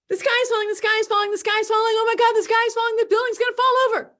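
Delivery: high, loud frantic panic; panicked alarm. Pretend. Someone panicking about the sky falling, frantic and loud.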